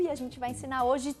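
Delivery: usual speaking voice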